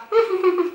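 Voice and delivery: very high scornful laugh; high mocking laugh. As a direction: cackle at him. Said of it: "el graciosillo madrileño".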